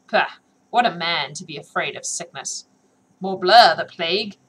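loud mocking scoff; scornful scoffing. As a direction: sneer at his cowardice. Hag scoffs at guard.

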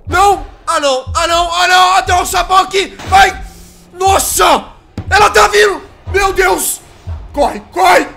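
high, loud frantic yelling; panicking gamer. Real, not acted. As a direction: scream in panic while fleeing. Chased by the nun.

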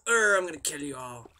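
lightly put-on menacing growl of a threat; mock battle threat. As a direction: play-act charging in to attack. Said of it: "I'm gonna say, go".

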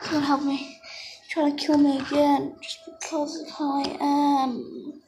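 ordinary talk, nothing marked

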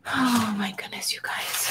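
whispered oh my goodness; dismayed whisper. Real, not acted. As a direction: whisper it in quiet dismay at a lost piece. Searching for her lost butterfly.

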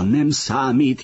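high, slow narrated call; shouted. A narrator's reading. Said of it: "kiáltotta Filó". (magasabb hangú, nyújtott kiáltás)

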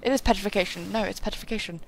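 ordinary talk, nothing marked

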